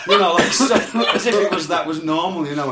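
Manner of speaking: loud laughing through the words; can barely talk for laughing. Real, not acted. Laughing with his mate at the tour tape.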